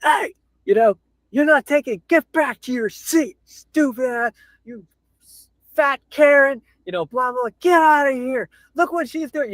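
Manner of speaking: mock a rude guy yelling at her; mock douchebag yelling. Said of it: "and be like".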